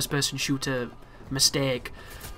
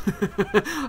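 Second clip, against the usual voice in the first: giggle; 7 semitones higher than usual. Real, not acted. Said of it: "effeminate German voice".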